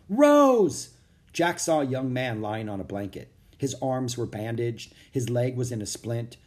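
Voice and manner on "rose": calling out high and loud across the room. Acted. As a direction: shout her name to find her. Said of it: "louder than the first one".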